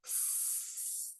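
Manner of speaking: long unvoiced s hiss; hissing s sound. Shown as a demonstration. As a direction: stretch out a hissing s. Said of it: "Sloth starts with this sound".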